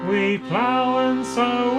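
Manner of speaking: ordinary delivery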